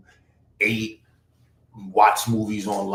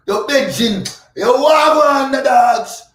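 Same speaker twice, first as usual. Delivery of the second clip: high, loud Jamaican greeting; Jamaican patois; 12 semitones higher, 9 dB louder, slower than usual. Pretend. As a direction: throw out a hyped Jamaican "Wagwan". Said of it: "we can talk like that".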